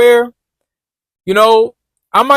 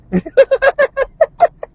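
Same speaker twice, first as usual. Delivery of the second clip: laugh. Real, not acted. Laughs at own joke.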